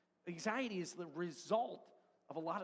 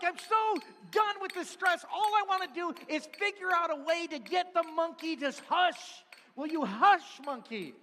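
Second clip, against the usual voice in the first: loud, higher, fed-up pleading; exasperated yell; 9 semitones higher, 11 dB louder than usual. Acted. Preacher yelling at the stress monkey to hush, loud and higher. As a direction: beg the monkey to shut up, at full volume. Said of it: "It's like".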